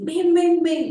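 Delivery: high loud "ven, ven, ven" calling; calling a child over, exaggerated; animated calling. Shown as a demonstration. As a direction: beckon a toddler with big excitement.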